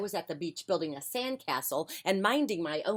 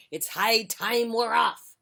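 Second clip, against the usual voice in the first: pirate voice; 5 semitones higher, 5 dB louder, slower than usual. Acted. Pirate captain.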